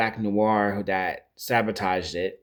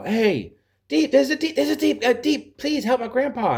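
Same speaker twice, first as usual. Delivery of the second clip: much higher, louder frantic call; pleading bystander; 4 dB louder than usual. Retold or imitated. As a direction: cry out for help high and desperate. Bystanders beg Deep for help.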